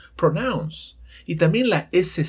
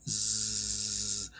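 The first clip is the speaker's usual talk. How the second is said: low, soft buzzing zzz; buzzing bee; 6 semitones lower, 9 dB quieter than usual. Shown as a demonstration. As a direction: hum like a bumblebee passing. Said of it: "le metemos el sonido de las cuerdas vocales".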